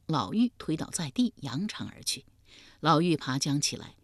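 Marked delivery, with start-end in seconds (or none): none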